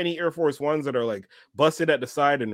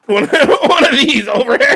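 loud, high words through laughter; real laughter bursting into the words; laughing; 11 semitones higher, 12 dB louder than usual. Real, not acted. Listener cracks up at the story.

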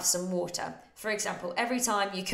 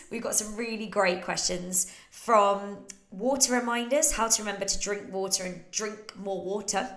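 normal talking voice

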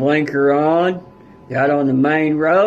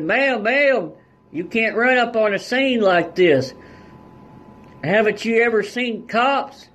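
voicing cop; 7 semitones higher than usual. Cops yelling at mom.

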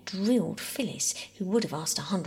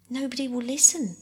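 louder, higher, bitter retort; 5 semitones higher, 6 dB louder than usual. A narrator's reading. Mother to Bobby about being unheard, very bitterly. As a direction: say it with open bitterness. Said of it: "said Mother very bitterly".

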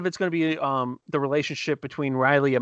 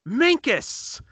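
excited call; 11 semitones higher than usual. Retold or imitated. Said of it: "Corey points at him and goes".

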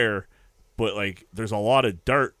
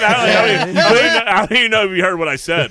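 high, loud, laughing through the words; can't believe nobody cared, laughing; laughing disbelief; 10 semitones higher, 11 dB louder, faster than usual. Real, not acted. Telling friends his coworker ignored his fall, laughing out loud.

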